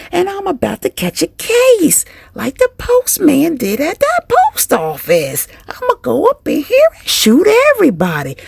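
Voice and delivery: high, exasperated venting; hammed-up fed-up rant. Stressed worker venting in the bathroom, mock furious. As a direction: rant as if at breaking point.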